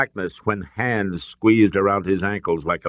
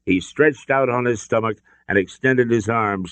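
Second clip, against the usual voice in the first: ordinary delivery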